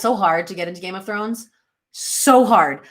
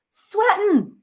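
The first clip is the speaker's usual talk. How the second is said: SWEATING pitched way up; squeal-high repeat of the word "Sweating"; comic emphasis; 12 semitones higher than usual. Real, not acted.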